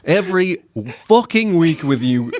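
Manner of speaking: loud, posh mock tirade; angry neighbour rant. Neighbour yelling in newsreader voice.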